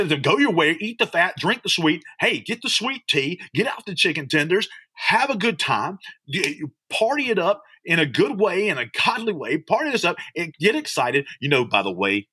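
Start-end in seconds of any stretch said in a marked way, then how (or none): 0.2-11.3 higher, lifted preaching; joyful pulpit preaching. Real, not acted. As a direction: preach the verse with joy. Preaching the verse.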